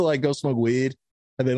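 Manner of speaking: normal talking voice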